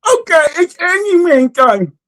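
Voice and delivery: high, slow comic character voice; hammed-up Handyman impression. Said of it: "So I would go".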